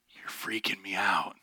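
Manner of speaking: slow, hushed, spooked whisper. Retold or imitated. Stranded man spooked by the coincidence, slow whisper. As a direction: whisper it like you've seen a ghost. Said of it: "And he's like".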